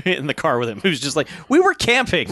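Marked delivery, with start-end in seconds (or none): 1.5-2.3 high confused voice. Retold or imitated. Acted quote of confused guy.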